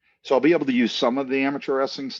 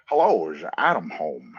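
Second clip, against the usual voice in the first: lower, imitating a Texan accent on the phone; Texan drawl; 5 semitones lower than usual. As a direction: mimic a Texas caller with a drawl. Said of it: "this Texan, Texas accent, and this kind of drawl".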